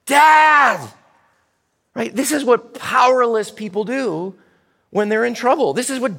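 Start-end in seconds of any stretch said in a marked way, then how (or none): 0.1-0.9 loud, high, drawn-out call for help; crying out. Acted. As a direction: panicky child yelling for parents. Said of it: "They cry out".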